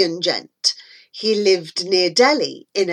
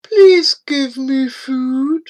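slow, pleading anteater voice; slow, pitiful creature plea; crying creature; 3 semitones higher, slower than usual. A narrator's reading. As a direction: beg plaintively, drawn out. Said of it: "the creature cried".

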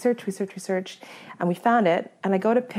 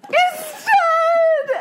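very high, hammed-up shriek; retold screaming; 5 dB louder than usual. Hunter with the head in her hand, screaming. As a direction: shriek it in comic distress. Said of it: "I kind of a little bit screaming".

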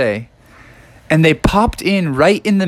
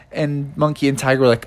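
ordinary delivery, nothing marked

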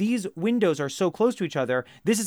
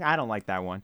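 usual speaking voice

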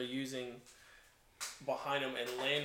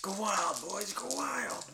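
high, drawn-out whoop to the gang; goblin rallying cry; 6 semitones higher, slower than usual. Acted. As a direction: cheer the goblins into a frenzy. Player cheers goblins into attack.

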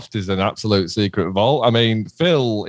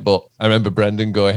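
ordinary delivery

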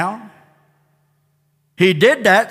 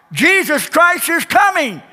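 building preaching; 4 semitones higher than usual. Acted. Preacher pressing the congregation, building to full force. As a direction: climb each repeat higher.

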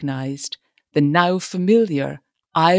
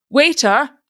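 high, loud summons across the room; loud call; 9 semitones higher, 5 dB louder than usual. A narrator's reading. Father loudly calls a man he takes for a waiter. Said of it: "he went on loudly".

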